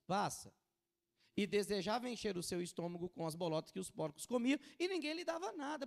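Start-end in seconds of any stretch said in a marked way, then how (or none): 1.4-5.9 loud, declaimed Bible verse; ringing pulpit reading; forceful scripture reading. Acted. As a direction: proclaim the passage to the congregation.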